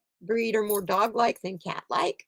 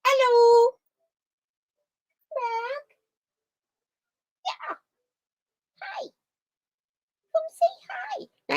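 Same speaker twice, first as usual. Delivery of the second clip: coaxing the cat; slower than usual. Real, not acted. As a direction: lure the shy cat out in a high coo.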